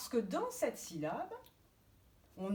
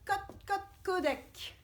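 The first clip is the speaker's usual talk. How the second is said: quick, higher hen-like clucks; hen clucking; 11 semitones higher than usual. Shown as a demonstration. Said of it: "la poule, elle fait".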